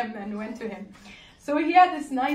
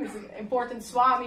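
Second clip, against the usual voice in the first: normal talking voice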